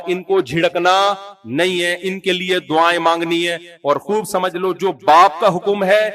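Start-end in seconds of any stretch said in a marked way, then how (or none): none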